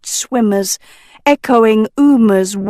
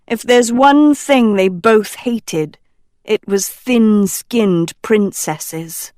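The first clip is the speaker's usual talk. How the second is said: usual speaking voice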